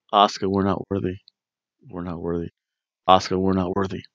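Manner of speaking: low, soft reverent chant; hushed, low mock reverence; mock worship.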